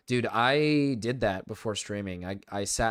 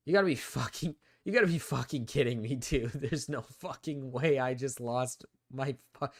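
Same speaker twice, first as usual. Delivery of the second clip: exasperated disbelief; 3 semitones higher than usual. Real, not acted. Furious disbelief at losing a Pokemon.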